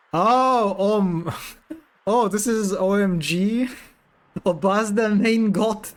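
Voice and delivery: can't stop giggling at the word; real laughing exclamation; giggly outburst. Real, not acted. Giggling over funny cheese word.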